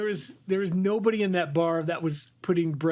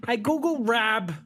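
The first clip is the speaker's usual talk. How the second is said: put-on Lake Erie accent; 6 semitones higher than usual. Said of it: "Lake Erie accent with my New York accent".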